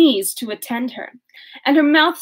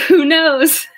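a louder who knows broken by laughter; laughing who knows; 5 semitones higher, 4 dB louder than usual. Real, not acted. Reader amused at stumbling over eclat, laughing. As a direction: laugh off the fumbled word.

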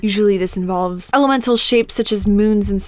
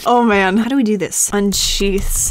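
ordinary delivery

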